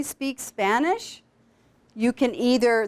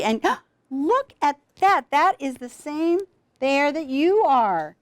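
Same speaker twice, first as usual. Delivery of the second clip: put-on lively, slow storybook voice for a toddler; reading to a child; 2 semitones higher, slower than usual. Big sister reading Brown Bear to her little brother, lively and slow. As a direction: draw the little one in with wonder.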